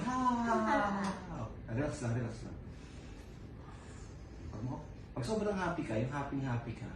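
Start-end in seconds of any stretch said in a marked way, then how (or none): 1.7-2.6 soothing calm. Real, not acted. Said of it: "Kapag sobrang happy ka".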